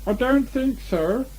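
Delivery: high, slow disguised rabbit voice; different voice. Acted. Rabbit disguises his voice to fool Pooh, high and slow. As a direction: Rabbit pretends to be someone else. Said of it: "in a different sort of voice".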